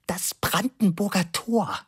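slow, high-pitched correction; measured, high-voiced informing; explaining. A narrator's reading. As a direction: enlighten him, word by word. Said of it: "sagte aufklärend zu Labude".

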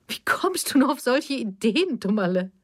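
high-pitched, amused incredulity; lightly scoff, as if it were absurd; amused disbelief. A narrator's reading. Mother ridicules her daughter's question, amused and incredulous. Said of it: "Jetzt lachte Evelyn laut auf".

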